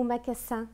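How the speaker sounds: "magasin" with a hissed s in the middle; S sound. Shown as a demonstration. Teacher offers students the wrong choice, "magasin" said with s. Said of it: "qu'est-ce qu'on dit ici?".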